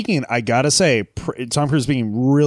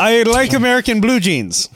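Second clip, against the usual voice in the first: mock tourist voice; 9 semitones higher, 5 dB louder than usual.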